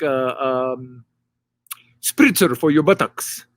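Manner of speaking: put-on proud Arabic-accented host, searching for words; an Egyptian host hunting for English words. Egyptian host explaining bathroom.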